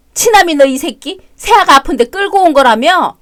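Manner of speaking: mother-in-law scolding her son; angry scolding. Acted. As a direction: tear into him. Heated family quarrel.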